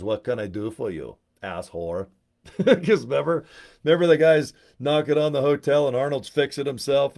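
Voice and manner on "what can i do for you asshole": lower, put-on robotic tough-guy voice; deadpan cyborg, dropping low on the insult; Arnold Terminator voice. The Terminator answering the user, lower and deadpan. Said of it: "a guy named Arnold ... he goes".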